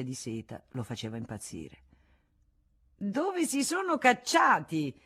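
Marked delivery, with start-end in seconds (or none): 3.0-5.0 high, slow call down the corridor; Hussonnet calling. Acted. Hussonnet looks for them in the corridor, high slow call. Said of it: "disse la voce di Issonnet".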